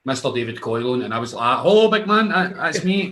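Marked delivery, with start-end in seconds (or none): 1.6-3.1 high, loud, delighted; thrilled hello to the chauffeur; excited greeting. Retold or imitated. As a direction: greet the driver like a mate. Retold greeting to driver.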